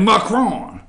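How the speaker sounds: Macron with a high, falling French last syllable; mock-French pronunciation. Shown as a demonstration. Radio host repeating the French president's name for listeners, Macron in showy French. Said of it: "You gotta say it like that".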